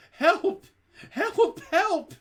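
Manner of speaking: very high, loud screams; boy screaming for help. A narrator's reading. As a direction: cry out desperately. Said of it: "I began to scream".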